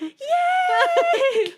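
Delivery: high, loud cheer; cheering yay. Retold or imitated. Family cheering at the dinner table, loud. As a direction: shout it out in joy. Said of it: "we all just start going".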